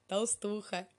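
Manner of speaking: rude jibe. A narrator's reading. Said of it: "добавил совсем уж грубо".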